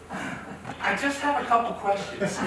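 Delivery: a light imitation of the tax lady, drawn out; imitating the tax lady. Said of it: "She does this".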